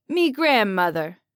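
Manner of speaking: a hint of proud Italian grandson; Italian accent. Jal presents his grandmother, Italian accent. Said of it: "in a heavy Italian accent".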